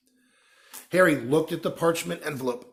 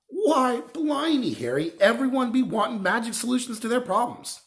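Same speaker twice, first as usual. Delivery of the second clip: Hagrid, coaxing; 11 semitones higher, 4 dB louder than usual. A narrator's reading. Hagrid voiced higher.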